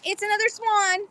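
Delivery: high, delighted squeal of surprise; thrilled to find another swan; excited. Real, not acted.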